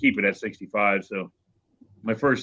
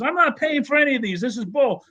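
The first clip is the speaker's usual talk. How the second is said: much higher, hammed-up furious refusal; mock furious trucker; 13 semitones higher than usual. Trucker yelling back at the judge, hammed-up fury. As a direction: play the trucker losing it. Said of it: "he's all mad about it".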